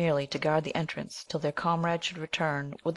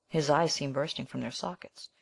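ordinary delivery, nothing marked

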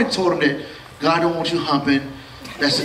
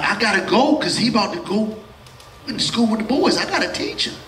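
ordinary talk, nothing marked

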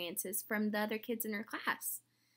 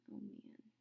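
whisper; 13 dB quieter than usual. Real, not acted. Sad aside to children.

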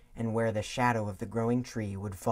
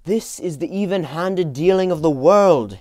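put-on loud, raised young Scrooge; bitter young man; 7 semitones higher, 13 dB louder than usual. A narrator's reading. Young Scrooge to his fiancée, loud and bitter. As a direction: declaim it like a man defending his greed.

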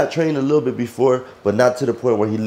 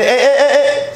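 sharp call; 14 semitones higher, 6 dB louder, slower than usual. Real, not acted. Calling out sharply at the dog.

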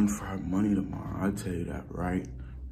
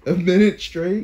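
surprised; 10 semitones higher, 11 dB louder than usual. Real, not acted. Laughing disbelief at tickle deal.

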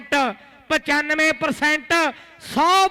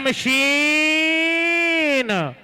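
drawn-out hype call; much slower than usual. Real, not acted. Nickname drawn out by commentator.

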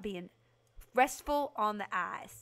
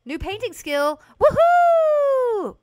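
high, loud celebration; thrilled, ringing whoop; excited cheer; 17 dB louder, much slower than usual. Real, not acted. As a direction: whoop it up over a win. Celebrates new skill in game.

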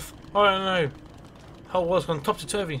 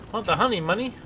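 put-on higher, whining like a greedy child; mock whiny plea; 6 semitones higher, faster than usual. Begging the bees to give up the honey, higher and whiny. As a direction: beg for the honey like a spoilt kid.

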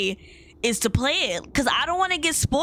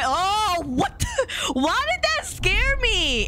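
high startled squeal, drawn out; 9 semitones higher, slower than usual. Real, not acted. Player startled by the game, high shriek of alarm. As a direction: squeal it slowly in real shock.

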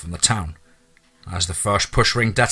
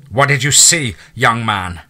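coldly insistent; 3 semitones higher, 6 dB louder than usual. Acted. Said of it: "calm, controlled, uncaring low voice".

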